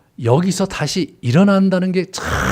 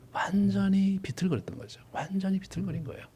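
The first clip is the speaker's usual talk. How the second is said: hushed emphasis; 5 semitones higher, 10 dB quieter than usual. Real, not acted.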